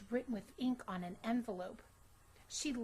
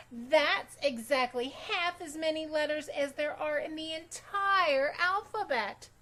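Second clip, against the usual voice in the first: loud, higher, smug recital; know-it-all; 6 semitones higher, 8 dB louder than usual. Acted. Victoria tattling to the teacher, loud smug know-it-all. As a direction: show off every fact.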